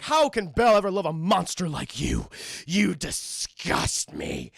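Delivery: loud villain's taunt, slowing right down at the end; hammed-up contempt, drawing out the last words; mock disgust. Gaston sneers at Beast, acted.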